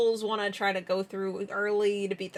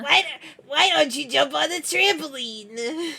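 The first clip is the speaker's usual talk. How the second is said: creepy character voice; 4 semitones higher, 4 dB louder than usual. Acted. Continuing the creepy character bit.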